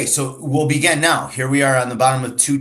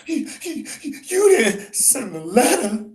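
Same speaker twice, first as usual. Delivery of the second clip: slow, crying gasps; gasping; 12 semitones higher, slower than usual. Acted. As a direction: gasp the words out between sobs. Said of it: "she asked in gasps".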